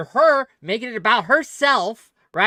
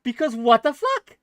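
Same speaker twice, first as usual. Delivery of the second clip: heated outburst; 8 semitones higher, 4 dB louder than usual. Real, not acted. Heated argument about cake.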